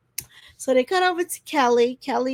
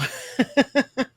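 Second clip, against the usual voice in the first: higher-pitched amused laugh; real laugh; 4 semitones higher than usual. Real, not acted. Host laughing at the trifling girl, real amused laugh. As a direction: laugh at the story just told.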